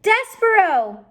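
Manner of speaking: loud, high, slow call; shouted. Acted. Princess calling back to Despero, loud shout. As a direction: cry his name out. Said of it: "then she shouted it".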